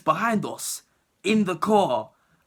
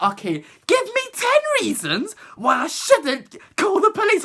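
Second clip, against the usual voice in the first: hammed-up threatening teacher; mock stern teacher; 12 semitones higher than usual. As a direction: spell out the ultimatum, pitched way up. Teacher demanding ten reasons.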